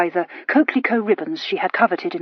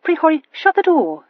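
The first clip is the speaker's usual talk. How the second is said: reproving; 8 semitones higher, 5 dB louder than usual. A narrator's reading. Sister scolding.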